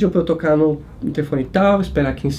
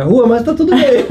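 laughing it off; 8 semitones higher, 4 dB louder than usual. Real, not acted. Laughing off near disaster.

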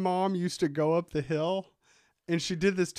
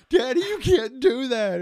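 put-on high, louder child scolding dad; kids scolding; 7 semitones higher, 6 dB louder than usual. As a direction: play the alarmed kid.

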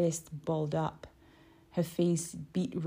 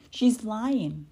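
smirk; 5 semitones higher, 6 dB louder than usual. A narrator's reading. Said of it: "smirked Sam".

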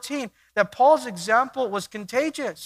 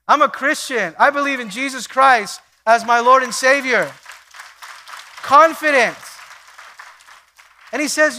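fervent loud preaching; 4 semitones higher, 5 dB louder than usual. Acted. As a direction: preach it with fire. Preaching at full force.

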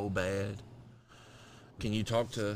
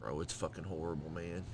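softer, low and weary; quiet; 4 semitones lower, 9 dB quieter than usual. Real, not acted. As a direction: say it softly, worn down. Diabetes pain talk.

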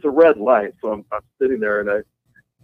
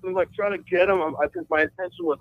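ordinary talk

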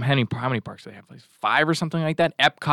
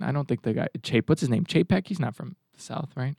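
ordinary talk, nothing marked

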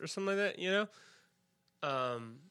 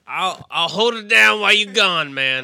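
loud, raised-voice send-off; calling out; 2 semitones higher, 11 dB louder than usual. Pretend. Guest left minding the show, hollering to departing hosts. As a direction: holler it after them as they leave.

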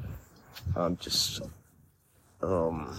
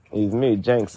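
ordinary talk, nothing marked

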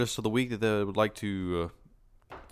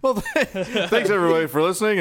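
loud, high laughing sign-off; cracking up while signing off; 9 semitones higher, 7 dB louder than usual. Real, not acted. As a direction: thank listeners through laughter.

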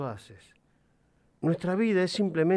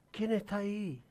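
softer echo, as if from far off; faint echo; 7 semitones higher, 9 dB quieter than usual. A narrator's reading. The mountain answers the boy, faint echo. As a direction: let it come back quietly like a distant echo. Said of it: "Le responde la montaña".

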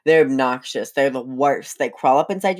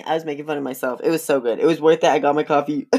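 ordinary talk, nothing marked

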